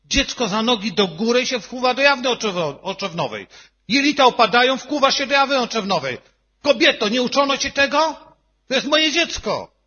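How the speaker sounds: loud, high, furious reenacted yelling at the nurse; father yelling at the nurse who can't find a vein, loud and high; retold angry shout. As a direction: shout it at her like she's failing your child.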